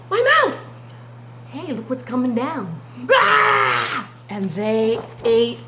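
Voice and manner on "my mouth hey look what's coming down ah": excited lions. Acted. Lions see men falling.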